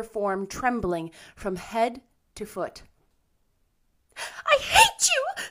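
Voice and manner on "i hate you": very high, loud tearful outburst; choked angry cry. A narrator's reading. Anne rages at Missus Rachel, choked cry. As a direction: drag it out in choked fury. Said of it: "she cried in a choked voice".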